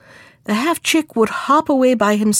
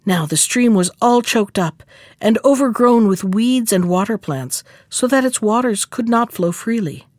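ordinary delivery, nothing marked

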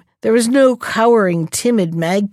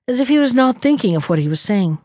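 ordinary delivery

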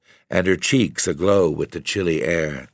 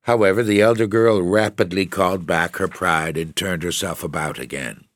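ordinary delivery

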